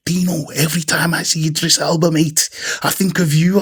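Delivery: hammed-up Scouse, badly faked; bad Liverpool accent. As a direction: put on a big, high Liverpool accent. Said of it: "That was a shit at Liverpool".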